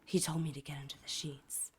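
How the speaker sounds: imitating a hushed, low monotone; near-whisper it with no inflection. Neighbor talking to someone unseen in the room, monotone. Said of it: "his voice was all of a sudden monotone".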